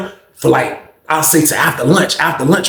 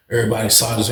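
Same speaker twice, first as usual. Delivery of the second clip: ordinary delivery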